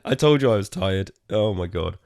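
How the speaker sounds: ordinary talk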